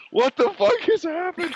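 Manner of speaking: high shouted what the fuck; dad's baffled shout. Retold or imitated. Dad bursts in on the fight, high baffled yell. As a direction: burst in yelling like a startled dad. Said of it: "came in and was like".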